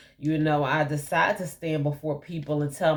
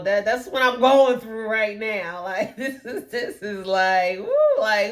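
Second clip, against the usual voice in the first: laughing; 5 semitones higher, 4 dB louder than usual. Real, not acted. Laughs about her current journey.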